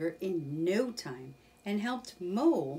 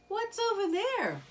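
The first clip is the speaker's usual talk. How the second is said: curious Mole; 3 dB louder than usual. Acted. Mole points to wood.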